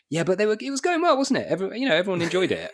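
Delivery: chirpy high-pitched excuse, brushing it off; high breezy excuse. Retold or imitated. Comic who overran, breezily excusing it to the host. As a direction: play down the overrun in a sing-song high voice.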